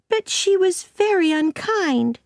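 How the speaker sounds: put-on high-pitched hurt girl talking back; protesting Anne. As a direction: object hotly in a child's voice.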